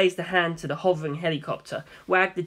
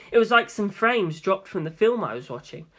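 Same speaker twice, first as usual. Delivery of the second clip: usual speaking voice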